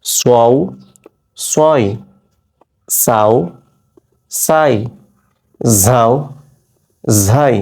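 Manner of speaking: crisp, isolated syllables with a pause after each. Shown as a demonstration. Teacher demonstrating pronunciation to students, crisp isolated syllables. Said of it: "এই উচ্চারণটা করছি আমি জিহ্বার আগা".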